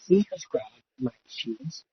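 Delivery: ordinary talk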